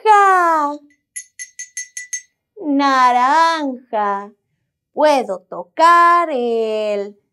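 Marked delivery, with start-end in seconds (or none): 0.1-0.8 "naranja" sung out higher and louder; excited. Acted. Playing the orange bar for the children, "naranja" high and excited. 2.6-4.3 "Naranja" drawn out slowly, each syllable clear; slow naming. Shown as a demonstration. Teaching the children the colour, "Naranja" slow and clear.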